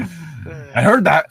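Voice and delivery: slow, drawn-out menacing line; menacing father. Acted. Coked-up father warning whoever dials 911, slow. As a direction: drag it out slowly like a threat.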